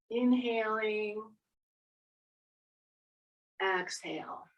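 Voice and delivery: soft, slow breathing cues; guided breathing. Acted. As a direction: call each breath gently.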